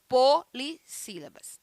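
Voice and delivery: "polissílabas" slowly broken into po, li, sílabas; syllable by syllable. Shown as a demonstration. Teacher correcting the class on the classification, syllable by syllable on "polissílabas". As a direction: break up "polissílabas" with gaps after "po" and "li".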